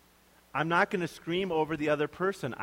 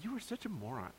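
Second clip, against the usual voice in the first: soft scornful insult; 11 dB quieter than usual. Pretend. Imagined insulting Jesus.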